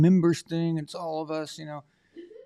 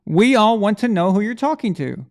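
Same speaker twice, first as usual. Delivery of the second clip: loud, raised call to someone out of the room; high, projected repeat of a question; 5 semitones higher, 6 dB louder than usual. Real, not acted. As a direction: call it out so they hear in the next room. Repeats the call across the house.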